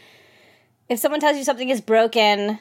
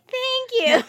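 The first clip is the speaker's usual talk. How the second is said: higher, awkward little thank-you; mock awkward thanks; 10 semitones higher than usual. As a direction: dodge the request with a bright thanks.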